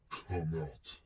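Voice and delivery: usual speaking voice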